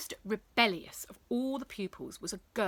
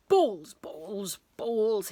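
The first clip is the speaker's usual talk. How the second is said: muttering teacher; 5 dB louder, much slower than usual. Acted. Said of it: "he muttered to himself".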